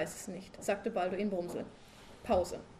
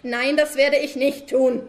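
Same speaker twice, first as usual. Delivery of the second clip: shout; 4 semitones higher, 13 dB louder than usual. Acted. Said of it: "schrie Balduin Brumsel". (lauter, langsamer Ausbruch des genervten Ehemanns)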